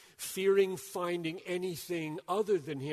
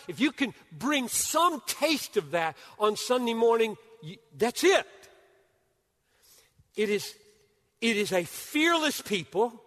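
higher, louder, driving fast on the key point; full-force preaching; 9 semitones higher, 10 dB louder than usual. Acted. Preacher firing up the congregation, loud and high. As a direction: preach it hard, building through the list.

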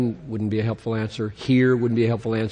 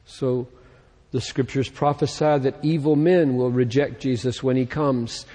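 normal talking voice

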